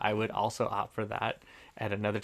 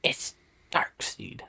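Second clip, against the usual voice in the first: whispered; slower than usual. Shown as a demonstration. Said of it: "We would say".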